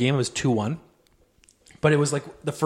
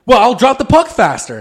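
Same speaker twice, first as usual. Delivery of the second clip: higher, louder mock snap; imitated angry retort; 8 semitones higher, 7 dB louder than usual. Player retorts to the ref, higher mock annoyance. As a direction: throw it back as an annoyed player. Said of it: "they were just getting so mad".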